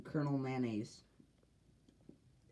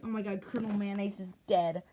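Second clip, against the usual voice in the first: shocked; 6 semitones higher, 4 dB louder than usual. Retold or imitated. Character finds the colonel dead.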